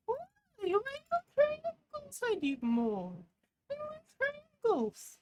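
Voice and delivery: very high, slow squeaky whine; squeaky whiny voice. Pretend. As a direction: whine like a tiny cartoon creature. Squeaky put-on voice, whining.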